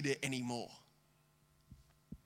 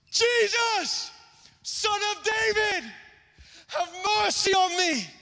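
desperate shout; 14 semitones higher, slower than usual. Shown as a demonstration. Preacher showing the blind man's real cry, desperate shout. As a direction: shout it to pierce the crowd. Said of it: "it's more like this".

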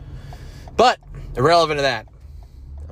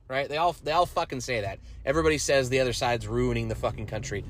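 usual speaking voice

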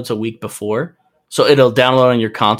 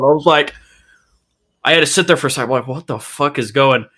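usual speaking voice

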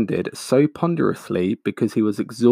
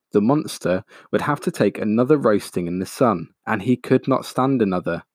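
usual speaking voice

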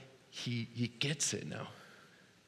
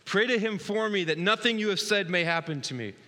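higher and louder, urgent begging; pleading Simon; 7 semitones higher, 6 dB louder than usual. Retold or imitated. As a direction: beg the apostle to pray for you. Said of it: "Look at what Simon says to that".

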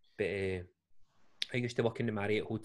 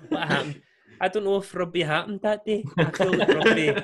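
laughing; 8 semitones higher, 9 dB louder than usual. Real, not acted. Laughing at his own boozy story.

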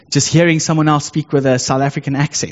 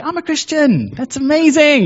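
much higher and bright with delight; excited; 13 semitones higher, 4 dB louder than usual. Retold or imitated. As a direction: gush with happy excitement. Excited reply to coworker.

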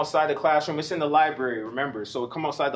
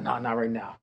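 low, softer dismissive reply; retold brush-off; 4 semitones lower, 5 dB quieter than usual. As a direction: wave the friends off in a lowered voice.

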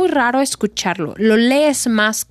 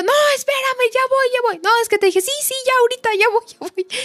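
much higher, hurried "coming, coming"; flustered stalling; 14 semitones higher, faster than usual. Retold or imitated. Jumping up as her partner fetches his own coffee, flustered and high. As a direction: scramble to stall him, pitch way up. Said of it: "y me levanto y yo".

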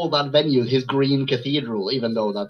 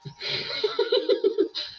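high breathless laugh; 4 dB quieter than usual. Real, not acted. Reacts to butler trivia.

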